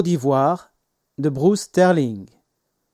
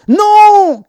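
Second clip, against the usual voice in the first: long, high, loud shout; cried out; 12 dB louder than usual. A narrator's reading. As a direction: shout it high and drawn out in alarm. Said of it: "cria Peter".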